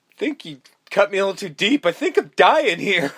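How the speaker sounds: high, loud pained whine; Stu dying. Retold or imitated. Host as over-stabbed killer, whining in mock pain. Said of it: "Stu is literally at one point just going".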